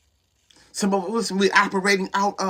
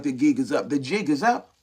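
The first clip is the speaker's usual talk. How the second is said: lower, half-spoken put-on voice, lightly voiced; mock knowing voice; 7 semitones lower, 4 dB quieter than usual. Preacher teasing the church, lower mock voice. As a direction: tease the congregation, jig is up in a lower put-on voice. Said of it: "I'd be like".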